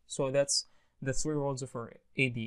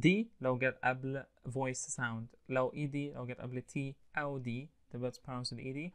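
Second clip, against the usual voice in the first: ordinary delivery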